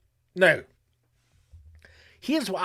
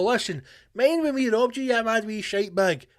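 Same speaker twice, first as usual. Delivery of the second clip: mock taunt; 7 semitones higher than usual. Voicing robbers taunting on phone.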